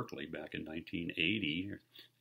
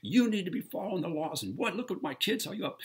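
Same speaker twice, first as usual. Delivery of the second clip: high, loud scolding rant at the dog owner; father at the park scolding a dog owner, reenacted rant; retold tirade; 10 semitones higher, 4 dB louder than usual. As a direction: unload on him, voice pitched up high. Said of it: "I just unload on the guy".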